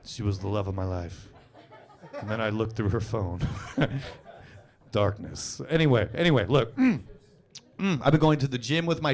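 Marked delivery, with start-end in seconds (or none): 0.0-5.6 lower, a touch of brooding drama; mock sad monologue. Comic fakes a tragic monologue under the spotlight, low and mock-sad. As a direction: play a lightly sad spotlight speech. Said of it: "about to do a sad monologue".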